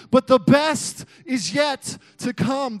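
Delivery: slow, loud preacher shout; shouting the promise to the congregation; preaching at full force. Acted. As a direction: preach it to the rafters.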